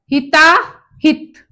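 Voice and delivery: हिताहित spelled out slowly, every syllable clear; slow teaching demo. Shown as a demonstration. (धीरे-धीरे साफ़ उच्चारण: हिताहित)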